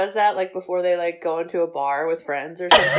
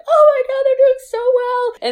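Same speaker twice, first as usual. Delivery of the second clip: mock tearful joy; 7 dB louder than usual. Podcaster acting out crying at the race, hammed-up tearful joy. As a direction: wail it as if overcome with happy pride. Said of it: "where I would start to cry".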